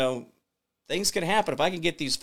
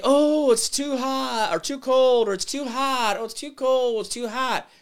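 whiny complainer; 10 semitones higher, 3 dB louder than usual. Retold or imitated. Host mocking people who complain it's too hot, whiny mock voice. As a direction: mimic people who fuss about temperature. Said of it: "we continue to say".

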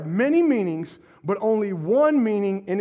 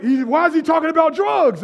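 higher, louder mock alarm; a hint of a worried churchgoer; 6 semitones higher, 5 dB louder than usual. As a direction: mildly play the confused listener. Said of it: "And I'm like".

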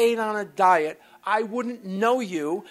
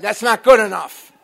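loud flat rejection; retold loud rebuke; 11 dB louder than usual. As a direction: shut the excuse down hard. Sponsor rejecting his answer.

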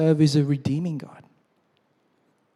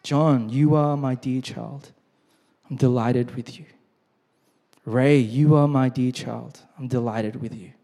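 slow, lowered, tender reading of the promise; slow personal reading; 5 semitones lower than usual. Shown as a demonstration. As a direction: let each word land slowly, as if spoken to John. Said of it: "read that sentence slowly with your name".

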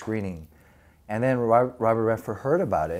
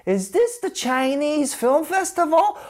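much higher, slow, put-on taunting voice; mocking taunt; slower than usual. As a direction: drag it out slowly as a sneering joke.

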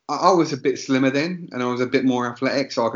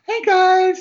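very high, loud squealed hello; bubbly colleague greeting; 6 dB louder than usual. Retold or imitated. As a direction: imitate an over-keen coworker popping up. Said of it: "She's like".

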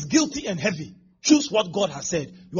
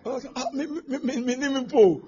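higher, hammed-up tearful stutter; mock crying stammer; 5 semitones higher than usual. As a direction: blubber the same word over and over. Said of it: "You know why he's crying?".

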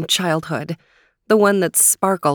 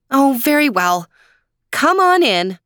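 grudging princess; 8 semitones higher, 4 dB louder than usual. Acted. Princess voiced in story.

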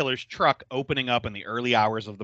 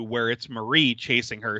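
usual speaking voice

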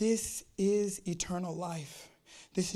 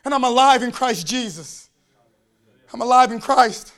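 loud, rising, half-sung preaching cadence; full-force preaching; 6 semitones higher, 17 dB louder than usual. Acted. Preacher testifying to the church, loud chanting sermon. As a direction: chant it out louder with every line.

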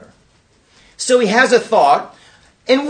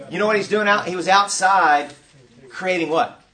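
ordinary talk, nothing marked

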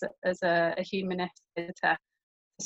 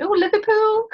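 put-on "Liverpool" parroted back in a much higher, louder Scouse accent; mock Scouse accent; 13 semitones higher, 6 dB louder than usual. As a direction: imitate a stranger hamming up a Scouse accent. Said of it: "in what they think is a Scouse accent".

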